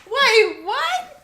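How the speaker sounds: very high, loud shocked question; stunned disbelief. Real, not acted. Listener startled by the Peppa caption, shrieking in disbelief. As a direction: shriek it in astonishment.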